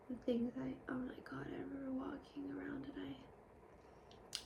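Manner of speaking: hushed aside, almost to herself; whisper. Real, not acted. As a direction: drop to a breathy secret whisper, slowing down. Hushed confession about senior year.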